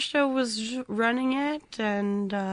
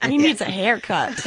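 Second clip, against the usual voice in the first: laughing as she jokes; 6 semitones higher, 7 dB louder than usual. Real, not acted. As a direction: chuckle through it. Joking, laughing.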